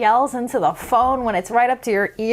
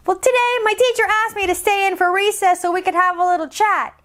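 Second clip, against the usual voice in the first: put-on slightly higher chatty kid; lightly voiced little girl; 6 semitones higher, faster than usual. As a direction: tell it like a quick kid. Decibella narrating.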